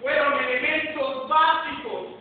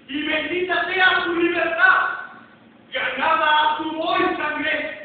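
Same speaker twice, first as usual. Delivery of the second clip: loud, raised declamation to a crowd; rousing oratorical cry; 3 semitones higher, 6 dB louder than usual. Acted. Patriotic climax of the oration.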